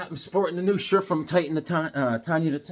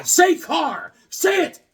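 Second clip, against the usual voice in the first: demanding mock; 12 semitones higher, 5 dB louder, much slower than usual. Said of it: "I'd be like".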